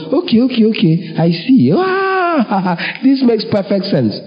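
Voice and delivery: high, exaggerated fake understanding with a laugh; mock convinced listener. Preacher mocking a listener who finally gets it. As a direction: play a worldly man pretending it all clicks. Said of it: "You want him to go".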